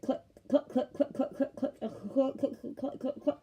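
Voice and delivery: repeated high dry clicking of a jammed trigger; mock misfire clicks, fading into a laugh; imitated gun clicks. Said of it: "I'm not gonna be like".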